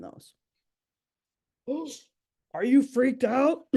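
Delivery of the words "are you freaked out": mock alarmed. Teasing audience about stress.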